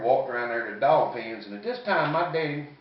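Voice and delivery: ordinary talk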